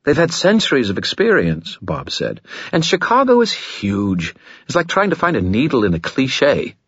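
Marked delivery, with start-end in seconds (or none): 0.0-1.8 higher, evasive sly spirit; coy skull voice. Acted. Skull Bob. 2.7-6.7 higher, evasive sly spirit; coy skull voice. Acted. Skull Bob.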